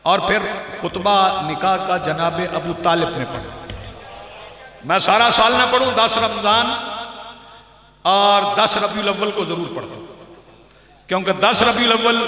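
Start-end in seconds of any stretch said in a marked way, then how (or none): none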